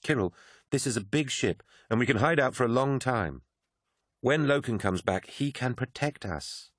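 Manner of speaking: a hint of an urgent Mercedy; Mercedy, a sharp young woman. Mercedy warning Sinderman about Malachhurst, insistent.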